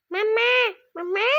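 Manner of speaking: high, loud call across the house; calling out Mama. Retold or imitated. Kid calling his mom to come, loud high call.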